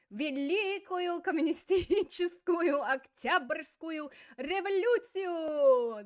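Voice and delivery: high, loud, slow exclamation; triumphant Lenin shout. Acted. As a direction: bellow it as Lenin's one obsession. Lenin's predictable answer, acted.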